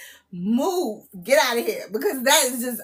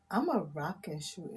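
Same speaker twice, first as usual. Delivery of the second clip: trying the line; 7 semitones lower, 10 dB quieter than usual. Pretend. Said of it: "I just wanna see what it feels like".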